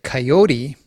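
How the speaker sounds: coyote as kai-OH-tee, stronger on the middle; three-syllable coyote. Shown as a demonstration. Host shows the other way of pronouncing, coyote as kai-OH-tee. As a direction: give coyote its final "tee". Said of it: "the other way of pronouncing it".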